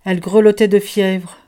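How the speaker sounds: ordinary delivery, nothing marked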